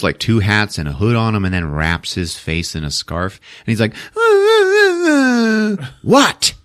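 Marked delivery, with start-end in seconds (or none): 3.9-5.9 put-on high, pulsing kid's whimper; mock whining wail. Boy wrapped in a scarf, mock whining wail. 6.0-6.5 high, snapped mock-irritated question; bitchy mom. Mom to her whining son, bitchy snap. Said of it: "Mom's all bitchy".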